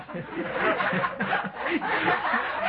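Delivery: long high-pitched laugh. Real, not acted. Speaker overcome by the drunk father's answer, laughing. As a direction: keep laughing, unable to finish the quote.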